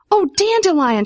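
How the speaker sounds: high, thrilled exclamation; delighted cry. A narrator's reading. Vivian greeting the dandelion, thrilled high cry. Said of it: "she cried".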